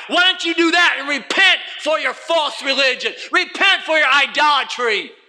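preaching call to repent. Acted. Preaching at full force.

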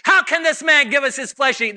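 loud, high outraged question; indignant shout. Retold or imitated. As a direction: throw the question back angrily.

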